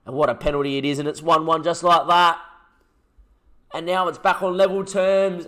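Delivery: gripped by the penalty drama; tense excited commentary. Real, not acted. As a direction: call the drama as it unfolds.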